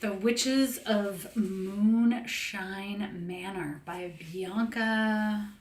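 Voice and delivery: very slow, drawn-out announcement; slow spooky book-title reveal; dramatic title reveal. Shown as a demonstration. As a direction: unveil the title like a spooky show. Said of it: "Are you ready?".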